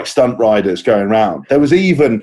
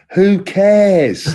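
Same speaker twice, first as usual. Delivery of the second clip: dismissive; 8 semitones higher than usual. Real, not acted. Dismissing the pedantic correction.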